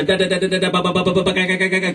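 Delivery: rapid stuttering CD-skip imitation; machine-gun syllables on one steady note; skipping CD noise. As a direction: imitate a CD jumping over bumps. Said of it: "when you hit a bump".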